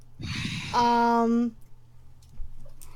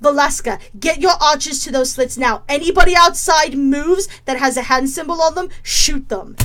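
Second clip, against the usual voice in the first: firm, rising loud battle commands; commander's orders; 3 dB louder than usual. Acted. Falgrim ordering the defence of the tower, building to commanding loudness. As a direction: bark orders to the troops, louder as you go. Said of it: "and goes".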